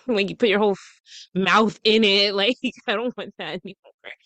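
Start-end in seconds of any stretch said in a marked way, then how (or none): none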